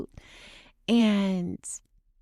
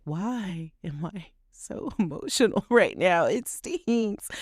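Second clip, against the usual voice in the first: teary laugh at her own emotion; mock-exasperated, tearful chuckle; laughing through tears; 3 semitones higher, slower than usual. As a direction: laugh it off while welling up. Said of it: "the water was coming out of my eyes".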